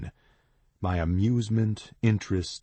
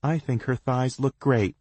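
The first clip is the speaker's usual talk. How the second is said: defensive; 7 semitones higher, 3 dB louder than usual. A narrator's reading. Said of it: "Cass says defensively".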